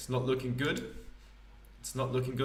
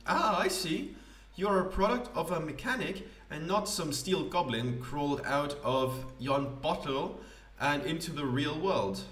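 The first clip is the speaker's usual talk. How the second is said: higher, grand theatrical poet voice; pompous poet; 4 semitones higher than usual. Acted. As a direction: play a self-important wandering poet.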